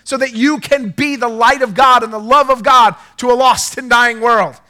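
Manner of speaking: loud, high declaring preacher voice; preaching at full force. Acted. Pastor declaring over his congregation, shouting. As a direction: proclaim it over the room with fire.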